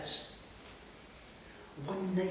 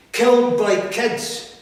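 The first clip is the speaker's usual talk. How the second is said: higher, louder and slow, accusing; anguished shout; 6 semitones higher, 10 dB louder, slower than usual. Acted. Telling the audience how he was killed, an anguished shout. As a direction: spit it out in grief and rage.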